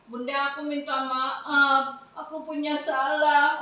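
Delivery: put-on child's apology. Pupil apologising to mother.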